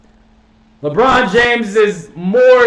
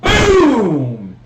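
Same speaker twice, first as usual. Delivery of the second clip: ordinary talk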